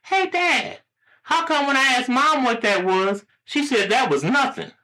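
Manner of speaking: put-on puzzled high-pitched kid; very high squeaky boy; little boy. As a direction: question Dad in a small child's voice. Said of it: "the boy pondered for a couple of seconds".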